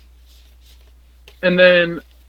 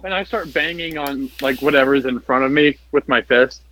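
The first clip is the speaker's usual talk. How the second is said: normal talking voice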